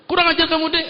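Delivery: a comic husband yelling at his wife; mock angry husband. Husband curses wife again, hammed-up anger.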